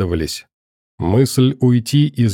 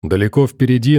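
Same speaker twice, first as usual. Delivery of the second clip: ordinary delivery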